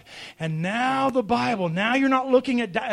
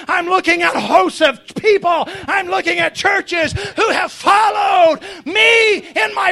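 God's decree, growing higher and more forceful; building preaching; 6 semitones higher, 8 dB louder than usual. Acted. God claiming his elected family before Satan, mounting fervour. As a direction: build from firm command to ringing proclamation.

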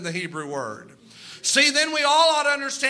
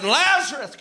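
commanding call; 4 semitones higher, 4 dB louder than usual. Retold or imitated. Jesus calls Lazarus from grave.

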